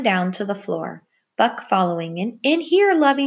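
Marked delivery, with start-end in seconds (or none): 2.2-3.3 high, loud call to someone out of sight; raised, high summoning voice. Acted. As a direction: call them in from another room, pitched high. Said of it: "she called".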